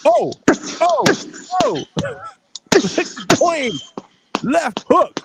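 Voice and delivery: high, loud hype shouts; excited cheering. Real, not acted. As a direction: cheer the left hook like ringside. Cheering a punch on camera.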